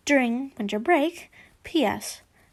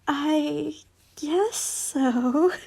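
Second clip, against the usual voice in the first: put-on slow, hesitant young voice; shaken, unsure; 3 semitones higher, much slower than usual. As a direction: let it drag out, unsure. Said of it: "with shock and fear and sadness".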